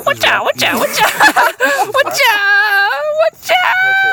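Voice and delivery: squeaky frantic high voice; high panicky squeal. Retold or imitated. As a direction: shriek it like a panicked little critter. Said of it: "look, he's like".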